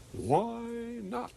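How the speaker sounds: high, stretched-out catchphrase holler; imitated distant yell. Friend yelling back a comedian's tagline from afar, imitated yell. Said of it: "a block and a half away yelled".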